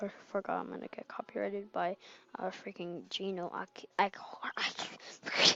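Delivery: hushed quick whisper; whispered aside. Real, not acted. Worried about copyright, whispering to himself. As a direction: drop to a secret whisper.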